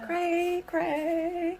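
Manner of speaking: high, loud silly cray cray; hammed-up high squeal; goofy squeal. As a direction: mess around in a squeaky voice. Goofing around as the doll.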